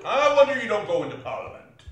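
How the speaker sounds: loud, high, quick taunt; mock the nephew's speechmaking; scornful, fast outburst; sarcastic jibe. Scrooge mocks the nephew's speech.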